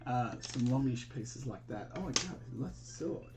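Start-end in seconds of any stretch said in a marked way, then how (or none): none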